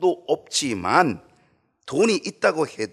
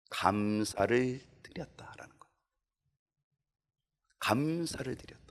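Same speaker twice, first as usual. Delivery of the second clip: hushed, intimate explanation; whispered meaning; 3 semitones lower, 8 dB quieter than usual. Acted. Gives the Greek word's meaning.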